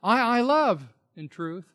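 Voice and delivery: doubled I, high and firm; emphatic I. Shown as a demonstration. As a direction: press on I, I before "love". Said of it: "It's an emphatic".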